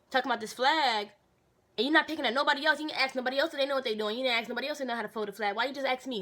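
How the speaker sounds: heated retort. Retold or imitated. To the sergeant singling her out, fast heated retort. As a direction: build the grievance quickly and loudly. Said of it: "I'm like".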